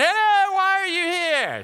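high, hammed-up British angel; bad British accent. Movie angel greets tomb visitors, hammed-up bad British accent. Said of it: "British accent".

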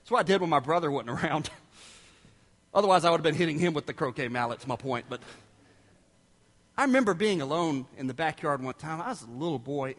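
normal talking voice

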